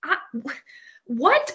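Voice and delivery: high, halting stammer into a sharp high what; lost for words, then a squeak of surprise; sputtering disbelief. Retold or imitated. Winifred stunned by the name, stammering high disbelief.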